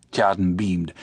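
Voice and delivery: usual speaking voice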